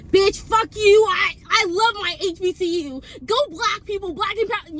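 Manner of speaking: hammed-up proud shouting; mock outburst. What she didn't want to yell in class, mock outburst.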